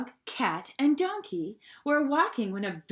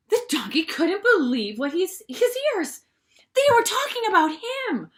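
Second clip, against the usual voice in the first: shocked narration; 6 semitones higher, 6 dB louder than usual. A narrator's reading.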